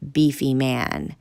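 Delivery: plain reading voice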